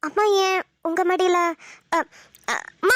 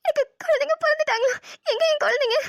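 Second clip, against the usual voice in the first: higher, sobbing through the words; crying, pleading; 6 semitones higher than usual. Acted. New mother begging her mother for the babies, crying. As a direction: cry out for her newborn babies.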